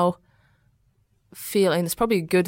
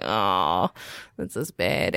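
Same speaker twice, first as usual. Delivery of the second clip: low mock dismay; 11 semitones lower, 3 dB quieter, much slower than usual.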